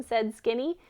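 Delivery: plain reading voice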